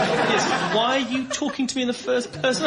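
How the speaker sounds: normal talking voice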